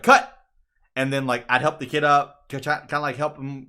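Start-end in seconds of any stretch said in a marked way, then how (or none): 0.0-0.3 loud high call of cut; a mock director's call. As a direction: shout cut across the set. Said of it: "They'd be like".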